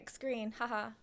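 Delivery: ordinary delivery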